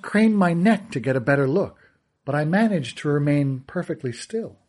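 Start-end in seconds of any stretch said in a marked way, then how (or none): none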